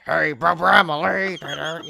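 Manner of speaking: put-on slow, loud, growly kid voice; little growly voice. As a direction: growl it out like a tough little orphan. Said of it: "the little growly voice she does".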